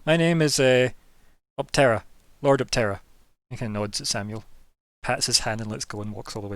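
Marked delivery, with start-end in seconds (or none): 0.1-3.0 grand self-introduction. Acted. Vampire introduces himself.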